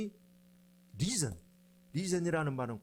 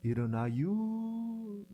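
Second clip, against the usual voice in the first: very slow Chungcheong drawl, higher; Chungcheong dialect; 4 semitones higher, much slower than usual. Shown as a demonstration. Preacher showing 'get up' in Chungcheong dialect, drawling. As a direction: stretch the ending right out, Chungcheong style. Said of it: "충청도 방언으로 하면은".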